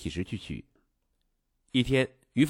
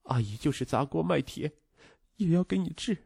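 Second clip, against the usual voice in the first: higher voice holding back tears; tearful; 6 semitones higher, 3 dB quieter than usual. A narrator's reading. Stepmother vows to pay for treatment, tearfully restrained. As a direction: hold back tears while promising. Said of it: "含着泪说".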